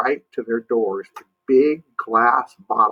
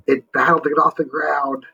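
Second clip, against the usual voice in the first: ordinary delivery, nothing marked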